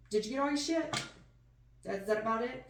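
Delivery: loud, high call to someone in the next room. Real, not acted. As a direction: shout the question across the house. Calling out to someone off-mic.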